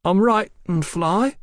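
put-on slow, high boyish slur; sluggish. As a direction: drag it out like a dazed boy. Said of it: "said Panther Bill sluggishly".

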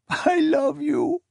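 high, slow, anguished declaration; acted tearful wail; crying out. A narrator's reading. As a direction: cry it out high and drawn-out. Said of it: "she cried".

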